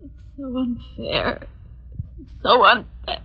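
slow, fading, pained; dying lament. Acted. Dying wife to her husband, slow and fading. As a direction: let the words drain away, slowly.

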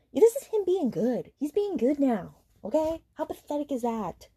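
sarcastic. Real, not acted. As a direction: drip with sarcasm. Sarcastic aside.

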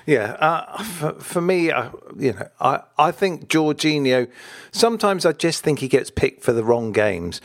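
ordinary talk

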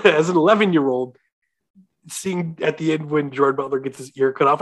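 ordinary talk, nothing marked

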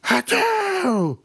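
very high, explosive imitated sneeze; loud fake sneeze. Mocking a loud sneezer in the mall, high explosive mock sneeze. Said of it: "hear someone go".

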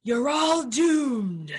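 slow, loud, high doom-crier shout; slow ominous raspy drawl. Pretend. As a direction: shout the prophecy slowly. Doom prophecy, Crazy Ralph style.

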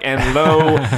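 loud, high, grand mock scripture; mock Bible reading. Joking about the Bible on the podcast, loud mock-scripture proclamation. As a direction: proclaim it like a pompous preacher. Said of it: "That's not in the Bible. Like".

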